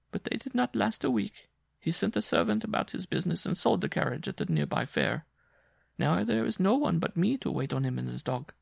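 a worn-out woman telling her hardship; Jean's voice. Acted. Jean continues.

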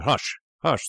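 high, rising urgent hush; exclaimed hushing. A narrator's reading. Doctor flustered by the unexpected meeting, exclaimed hush. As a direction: exclaim it to silence someone. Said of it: "exclaimed the doctor".

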